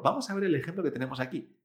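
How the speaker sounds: ordinary talk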